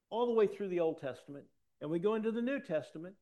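high, loud bursts of preaching; full-force preaching. Real, not acted. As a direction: hammer the questions home to the congregation.

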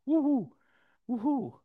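high, loud joyful whoop; excited cheer. Acted. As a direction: whoop it out like a happy crowd. Cheering at a miracle.

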